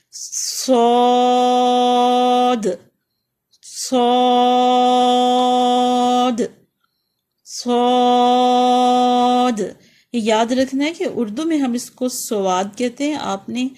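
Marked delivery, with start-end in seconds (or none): 0.1-9.6 صاد slow and loud, thick hiss, long aa; heavy hissed letter. Shown as a demonstration. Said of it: "صاد میں موٹی سیٹی ہوتی ہے".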